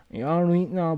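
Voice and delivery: broad Dublin Irish accent. Shown as a demonstration. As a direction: toss off a thick Dublin accent. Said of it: "that kind of a fucking Irish accent".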